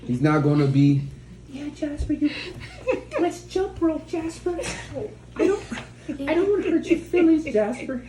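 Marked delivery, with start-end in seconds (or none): 1.5-8.0 high, timid falsetto, halting; mouse-like man. Retold or imitated. Weak man pleading with Jasper, mock mousy voice. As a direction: squeak it like a meek suitor. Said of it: "not gonna talk like a mouse".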